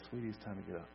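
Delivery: hushed, effortless tenderness; gentle waking. Acted. Jesus takes her by the hand, waking her gently. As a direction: softly coax a child awake. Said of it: "wake a child from a nap".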